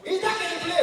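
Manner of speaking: a hammed-up high Igbo caller; high Igbo man voice. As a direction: sound like an eager Igbo trader on the phone. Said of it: "Igbo man".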